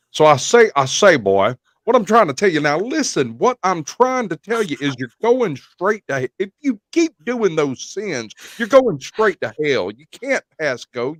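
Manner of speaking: Southern preacher warning about hell; Southern preacher Foghorn Leghorn. Shown as a demonstration. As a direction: thunder like a Southern Baptist preacher crossed with a cartoon rooster. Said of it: "Southern Baptist minister mixed with Foghorn Leghorn".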